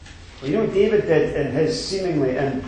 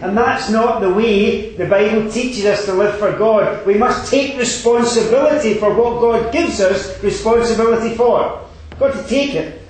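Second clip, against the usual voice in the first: higher, louder fervent exhortation; preaching at full force; 8 semitones higher, 6 dB louder than usual. Acted. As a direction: drive the congregation to take responsibility.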